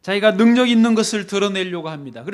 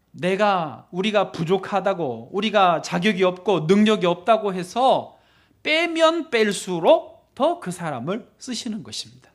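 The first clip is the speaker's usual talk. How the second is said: ordinary talk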